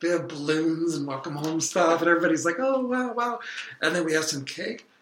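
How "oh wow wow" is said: high-pitched imitation of impressed well-wishers; pitched-up, play-acted admiration; mock amazement. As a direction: coo a put-on "wow" at a homecoming. Imitates everyone at welcome home party.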